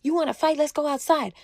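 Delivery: high-pitched yell, held back in volume; mock screaming challenge. Rival stood up to pick a fight, mock yelling. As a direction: imitate a yelled dare without the volume. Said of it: "she gets to screaming, yelling".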